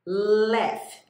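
"Laugh." said as "laf", ending on a clear F sound, no G; teacher modelling "Laugh." slowly and loudly, final F sound; "Laugh." pronounced like "laf", not with a G. Shown as a demonstration. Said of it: "Esse G é pronunciado com som de F".

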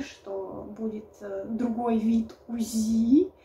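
hushed, slow whisper. Real, not acted. Whispered embarrassing detail. (шёпотом)

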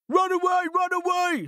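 very high, loud frightened cry; panicked scream. A narrator's reading. Terrified ogres fleeing the pears, panicked scream. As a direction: scream it as you flee. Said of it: "screamed the ogres".

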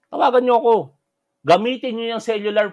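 loud, high-pitched demand; calling out. Real, not acted. Host challenges angry commenters to phone in, loud demand. Repeats the dare to call.

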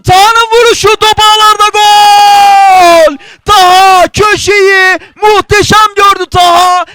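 loud, high, stretched goal scream; screaming football commentator. Real, not acted. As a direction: belt out the goal call. Second goal, commentator erupts.

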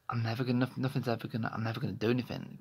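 normal talking voice

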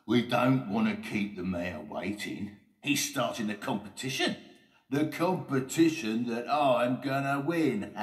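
swagger up to a gloating laugh; Oliver Overdrive, boastful. Acted.

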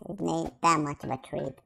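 ordinary talk, nothing marked

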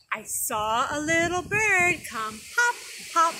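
put-on singsong bird rhyme for kids; poem recitation. Teacher reciting a poem to children, singsong. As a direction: bounce it like a nursery rhyme.

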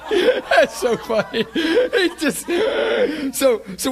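high, loud, laughing hard through the words; real cracking-up giggles, voice soaring; helpless laughter. Real, not acted. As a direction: barely get it out for laughing. Cracking up at own story.

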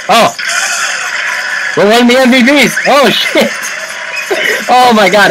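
real surprised excitement; excited shouting. Real, not acted. Unexpected MVP result.

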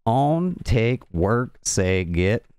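slow Southern accent, word by word; deliberate, stretched Southern word-list reading. Shown as a demonstration. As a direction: drawl each word separately in a Southern accent. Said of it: "trying to do this in the southern accent".

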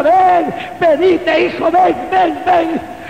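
high, urgent calling, acted out; Jesucristo llamando. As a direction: call a son to you in repeated loud cries. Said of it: "veía también a Jesucristo con sus manos extendidas". (imitando un llamado insistente, agudo y fuerte)

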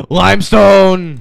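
loud, high, drawn-out cry; excited exclamation. Real, not acted. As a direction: stretch it out in a falling shout. Exclaims on finding the rock.

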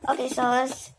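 ordinary talk

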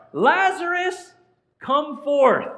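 high, loud, drawn-out summons; slow, ringing command; commanding call. Acted. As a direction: call the dead man out of the tomb. Acting out Jesus raising Lazarus.

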